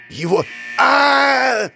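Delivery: high drawn-out cry of pain; agonized scream. Acted. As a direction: scream as claws hit. Man screaming as clawed.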